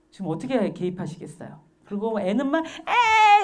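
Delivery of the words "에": loud, high-pitched fake bawling; imitated crying of a small child; mock child's wail. Five-year-old after being pushed, wailing. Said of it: "동생은 다섯 살짜리는 울고".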